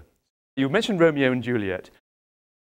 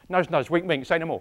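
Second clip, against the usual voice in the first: higher-pitched Cockney comic patter; Monty Python; 4 semitones higher than usual. Acted. Actor quoting a comedy sketch to the class, cheeky Cockney. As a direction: play the nudging Cockney sketch character. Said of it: "That comes from Monty Python".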